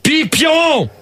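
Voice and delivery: high-pitched yell of a name; exasperated high shout; impatient shout. Real, not acted. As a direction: call out sharply to a caller who won't answer. Host calls unresponsive caller again.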